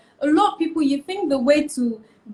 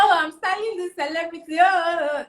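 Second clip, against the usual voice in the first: higher-pitched, quick starstruck brag; mock boasting; 5 semitones higher, faster than usual. Stylist mocking newcomers dazzled by celebrity clients, mock boasting. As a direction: imitate a show-off name-dropper.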